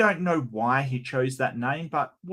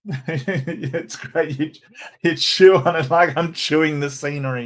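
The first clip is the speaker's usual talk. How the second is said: higher, laughter spilling into the words; laughing while talking; 3 semitones higher, 6 dB louder than usual. Real, not acted. Host amused by his own vowels, laughing. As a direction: talk through the tail of a giggle.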